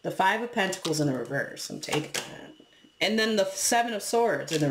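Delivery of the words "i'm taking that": breathy whisper to herself; whispered aside. Real, not acted. Reader noting a card to herself, whispered. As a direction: murmur it under your breath.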